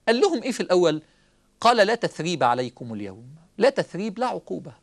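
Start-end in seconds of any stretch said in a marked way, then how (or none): 1.8-3.2 lower, measured recitation of the verse; Quranic recitation. Acted. Preacher quoting Yusuf to his brothers, low recitation.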